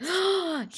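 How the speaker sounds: high, sudden audible gasp; gasp of recollection. Real, not acted. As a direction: suck in breath as the memory hits. Suddenly remembers the forgotten accident.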